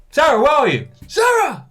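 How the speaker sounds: loud, high shout across the supermarket; calling across a store to find your wife, shouting. Retold or imitated. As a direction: call out loud and high for Sarah, who is lost in the shop. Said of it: "And I just shout".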